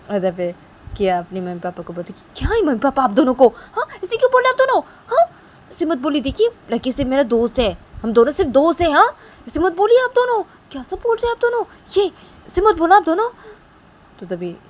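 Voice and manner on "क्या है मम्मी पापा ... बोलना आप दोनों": high, loud scolding of parents; नाराज़ बेटी. Acted. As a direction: tell your parents off, voice climbing high. (मम्मी-पापा पर ऊँची आवाज़ में झल्लाती बेटी)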